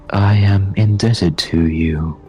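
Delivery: stately otherworldly guide giving thanks; grateful Navigator figure. Acted. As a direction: declare it slowly and formally. Game master voices Navigator.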